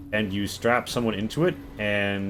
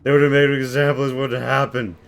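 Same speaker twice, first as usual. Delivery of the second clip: higher, louder, fast tearful rant; sobbing, rushed; 3 semitones higher, 6 dB louder, faster than usual. Acted. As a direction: pour it all out fast through sobs. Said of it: "but he's sobbing".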